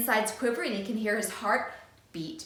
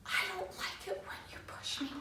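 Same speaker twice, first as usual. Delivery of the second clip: tiny, high whisper; scared whisper; 9 semitones higher, 10 dB quieter than usual. Acted. Frightened boy whispering his practice line. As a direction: barely dare to say it. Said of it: "whispers in a little tiny voice".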